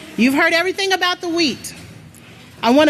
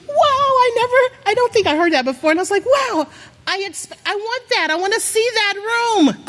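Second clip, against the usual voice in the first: higher, wide-eyed wow of wonder; retold amazement; 7 semitones higher than usual. Preacher recalling the prophecy film, excited wonder. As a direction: relive the moment you were amazed. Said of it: "And I was just like".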